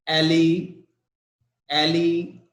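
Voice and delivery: "ele-" cut off, stress held on EL; stressed first syllable. Shown as a demonstration. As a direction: isolate "ele-" to show the stressed EL. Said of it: "the stress is on E L".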